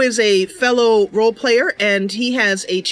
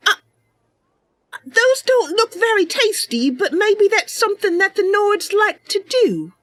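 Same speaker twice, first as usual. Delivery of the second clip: put-on very high, bright girlish character voice; perky Mercy voice; 10 semitones higher than usual. Mercy greeting a stranger brightly, very high and cheery. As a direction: play her as a cheerful young cat.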